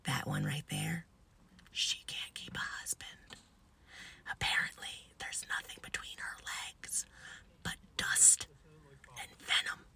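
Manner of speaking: hushed insult shared in confidence; gossipy whisper. Retold or imitated. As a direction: whisper the dirt with pauses for effect. Patient gossips secretly to teller.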